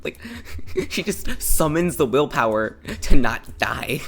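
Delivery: loud, drawn-out words broken by laughter; laughing, mock-epic. Real, not acted. Telling of a friend who stops choking, laughing and mock-dramatic. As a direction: stretch it out grandly while cracking up.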